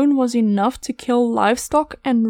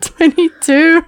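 laughing; 5 semitones higher, 7 dB louder, slower than usual. Real, not acted. Laughing confirmation of episode.

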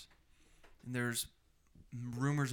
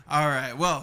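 loud; 3 semitones higher, 13 dB louder than usual. Real, not acted. Calming the table.

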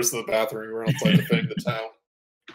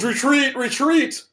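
high, loud panicked call; fleeing orc; 5 dB louder, slower than usual. Acted. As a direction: yell the retreat as you run. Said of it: "And he goes".

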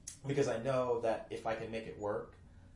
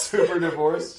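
repeated much louder and higher through laughter; laughing emphasis; 6 semitones higher, 14 dB louder, slower than usual. Real, not acted. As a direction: exclaim it, laughing.

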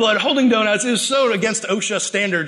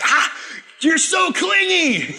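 high, slow mock shout at a clingy little brother; playful exasperated yell; annoyed sibling; 9 semitones higher, slower than usual. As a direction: whine it up high like a fed-up big sister. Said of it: "you're like".

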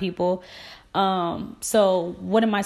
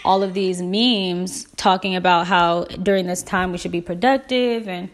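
ordinary talk, nothing marked

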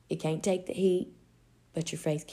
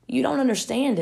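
higher, louder protest; talking teacup; 7 semitones higher, 6 dB louder than usual. Acted. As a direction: protest as the teacup, pitched up and emphatic. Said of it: "suddenly the teacup spoke".

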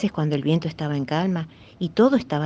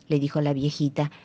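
ordinary delivery, nothing marked

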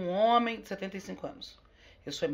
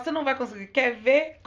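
louder, taunting quoted dare; daring challenge; 4 semitones higher, 6 dB louder than usual. Retold or imitated. Guest quoting someone who dares her, daring challenge. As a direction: throw down a louder mock challenge.

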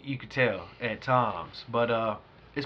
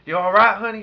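a hint of a country boy checking on her; 6 semitones higher, 6 dB louder than usual. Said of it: "he real redneck and shit".

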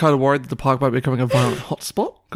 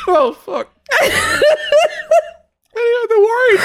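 laughing. Real, not acted. Laughing fit.

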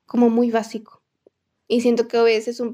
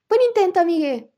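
mock teasing; 7 semitones higher, 4 dB louder than usual. Retold teasing from gamer.

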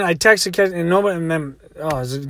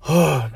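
big audible exhale; sigh of relief; 3 semitones lower than usual. Retold or imitated. Woman finds nobody dead, imitated relieved sigh. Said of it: "big sigh of relief comes over".

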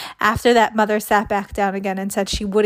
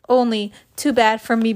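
plain reading voice

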